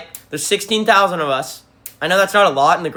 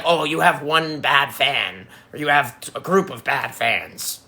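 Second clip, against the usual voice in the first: slow mock whine of a critic; slower than usual. Streamer mocking critics who blame the fans, slow sneer. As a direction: drag it out like a smug critic.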